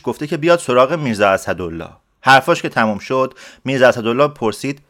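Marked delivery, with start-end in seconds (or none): none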